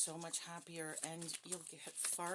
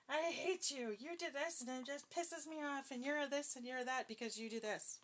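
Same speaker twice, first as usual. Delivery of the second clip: high, heated, accusing outburst; aggressive demo; 7 semitones higher than usual. Shown as a demonstration. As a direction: hurl blame at the other person. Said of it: "Aggressive is going".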